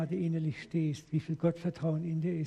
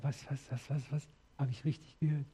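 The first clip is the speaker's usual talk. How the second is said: stunned disbelief; 5 dB quieter than usual. Retold or imitated. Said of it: "Ich war erst so verblüfft".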